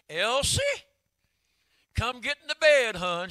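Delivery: high, loud call to his wife; Daddy calling. Retold or imitated. Daddy calling his crying wife back to bed, loud. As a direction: call out to Elsie from the bedroom. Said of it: "I'm gonna kinda tell you what he said".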